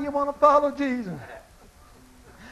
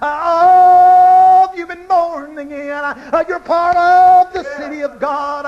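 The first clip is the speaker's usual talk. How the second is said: fervent cry; 3 semitones higher, 8 dB louder than usual. Real, not acted. Preacher climax cry.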